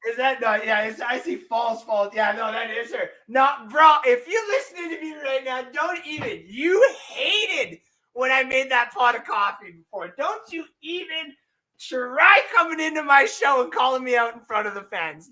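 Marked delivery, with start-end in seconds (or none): 3.3-6.4 playful high yell at the absent partner; mock scolding shout. 6.5-15.3 playful high shout at the fans' intruder; mock scolding. Playfully calling out absent partner.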